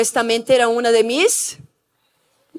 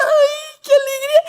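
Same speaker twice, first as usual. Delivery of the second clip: overjoyed cry; 11 semitones higher than usual. Retold or imitated. Joy beside the pastors.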